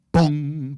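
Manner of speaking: loud, high booming impact noise; sudden loud "boom" like struck hollow metal; imitated metal clang. Describing a fall onto the tracks, imitated loud clang. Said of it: "That's the noise you hear".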